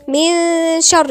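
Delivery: very slow, loud recitation of من شر; hidden-n ghunnah. Shown as a demonstration. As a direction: stretch the ن of من into a nasal hum leading into "sh". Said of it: "النون دي كأننا ما ننطقهاش خالص".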